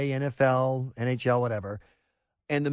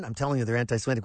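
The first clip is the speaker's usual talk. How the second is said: lower, fast neurotic rant; Woody Allen doing a fast worried rant; Woody Allen impression; 7 semitones lower, 3 dB quieter, much faster than usual. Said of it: "Woody Allen in the movie Manhattan".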